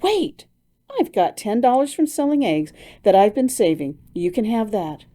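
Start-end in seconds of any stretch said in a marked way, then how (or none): 0.0-0.4 sudden idea. Acted.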